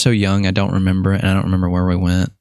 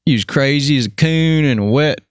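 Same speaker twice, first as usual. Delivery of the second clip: put-on Alabama accent, pitched up, slow in stretches; 4 semitones higher, slower than usual. Showing off for a guest on request, put-on Alabama accent. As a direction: ham up an Alabama country accent. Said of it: "Throw down your Alabama accent already".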